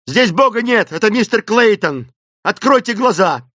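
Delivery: loud, high commanding shout. A narrator's reading. Clayton ordering Esmeralda to open her eyes, shouting. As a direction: shout to bring her round. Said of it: "закричал Клейтон".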